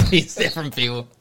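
usual speaking voice

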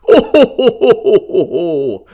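loud, high Santa laugh; jolly ho ho ho. Acted.

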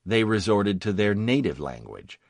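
usual speaking voice

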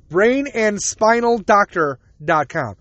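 "Brain and spinal doctor dot com" said slowly, each word set apart; slow careful plug. Shown as a demonstration. Giving listeners the surgeon's website, slow and spelled out. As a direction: read the web address out deliberately. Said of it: "Spelling out doctor".

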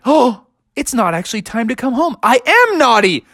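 high, hurried dawning horror; panicked realisation. Acted. Froggy sees he is skipping school, panicked. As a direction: gasp it out, then climb into alarm.

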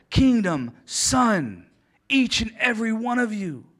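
loud, climbing pulpit build; preaching at full force. Acted.

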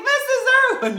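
high, loud, delighted call; excited greeting. Retold or imitated. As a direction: call out to the teacher you just spotted. Excitedly greets cello teacher, retold.

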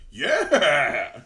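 loud, long, high-flying yell; drawn-out "yeah" shouted in glee; whooping cheer. Retold or imitated. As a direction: mimic a triumphant whoop. Retold driver cheers speeding off.

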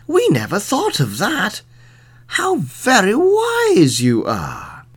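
high, drawn-out admiration; marvelling builders. A narrator's reading. Builders marvelling at the sheriff's wisdom, high and drawn out. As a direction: lift the voice and stretch the praise.

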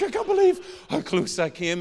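high, loud tearful wail, hammed up; mock sobbing. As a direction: imitate sobbing like a child. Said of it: "drove home sobbing like a child".

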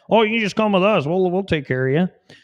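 high, put-on coaxing voice of the tempters; hammed-up welcoming devil worshipper; mock Satanist lure. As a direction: sweet-talk like a sly cult recruiter. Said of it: "all the Satanists will love that".

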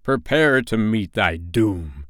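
slow, ominous villain; drawn-out theatrical menace; Hook. A narrator's reading. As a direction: deliver the threat word by word. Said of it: "said Hook".

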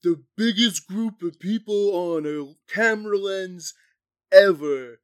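hammed-up German accent. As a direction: play a proud Nazi filmmaker in a German accent. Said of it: "That was my German accent".

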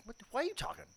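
mock outrage. Retelling a court spat with coach guy, high indignant retort. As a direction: squeak up in disbelief.

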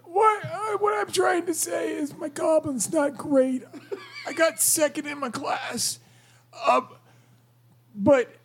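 a hammed-up goblin straining to speak; strained goblin voice. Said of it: "that accent and the drained voice".

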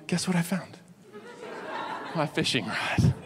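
quiet, laughing payoff; hushed reveal. Real, not acted. Pastor revealing he found the rod, hushed and laughing.

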